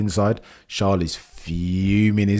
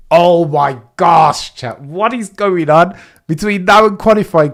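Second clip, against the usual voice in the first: excited exclaim; 6 semitones higher, 7 dB louder than usual. Real, not acted. Streamer exclaiming to chat about the chaos. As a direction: let it burst out to chat.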